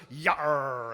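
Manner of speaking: growled pirate yar; lightly hammed-up pirate yar. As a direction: drop into a quick pirate growl. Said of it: "we started talking like a pirate".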